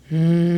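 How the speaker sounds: a short closed-mouth hum like a bee; gentle humming demo. Shown as a demonstration. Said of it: "hum out like a bee".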